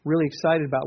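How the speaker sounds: ordinary talk, nothing marked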